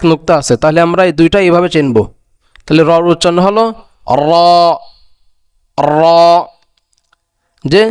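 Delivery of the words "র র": র with tongue tip's back on the palate, open "o"; Arabic ro. Shown as a demonstration. Teacher shows the Arabic letter র, tongue tip to palate. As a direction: roll into a full, rounded র. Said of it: "জিহ্বার আগার উল্টা পিঠ".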